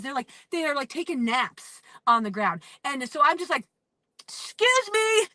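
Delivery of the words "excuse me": much higher, slow, sing-song polite excuse; a mock-sweet plea to squeeze past. Pushing through a crowd politely.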